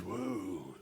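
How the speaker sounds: easy like a mellow beach uncle; a hint of an old surfer dude talking to kids. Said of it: "Surfer dude, he goes".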